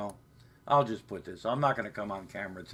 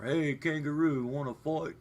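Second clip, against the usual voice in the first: higher-pitched taunting tourist; mock tourist; 6 semitones higher, 3 dB louder than usual. Silly tourist challenging a kangaroo to fight, mock taunt. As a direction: call out a playful challenge to the animal. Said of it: "when a tourist comes".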